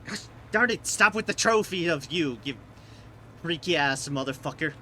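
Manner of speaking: loud, high, exasperated outburst; shaking with anger. Real, not acted. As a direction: yell at the screen in frustration. Raging at a trophy popup.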